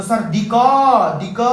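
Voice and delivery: student sounding out दिक as "di-kaw"; imitating a pupil testing the last sound of दिक; drawn-out pronunciation. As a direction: stretch the end of दिक into a long "aw". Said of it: "अब कहेंगे कि".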